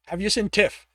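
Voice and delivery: a firm, urgent demand. Acted. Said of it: "he demanded of Elnard".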